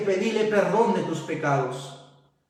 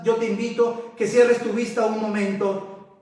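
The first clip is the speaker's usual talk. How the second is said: ordinary talk, nothing marked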